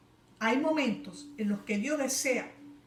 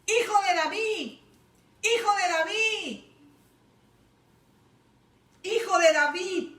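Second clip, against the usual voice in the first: high, loud pleading cry; blind man crying out; 9 semitones higher, 6 dB louder than usual. Retold or imitated. Blind beggar shouting to Jesus for mercy. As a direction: call out to Jesus over the crowd. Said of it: "comenzó a gritar diciendo".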